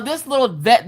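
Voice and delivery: ordinary delivery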